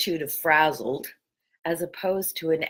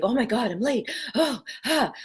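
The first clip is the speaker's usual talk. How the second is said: mock panic, tossed off lightly; a light send-up of a flustered morning; frantic energy; 6 semitones higher than usual. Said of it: "with that energy of".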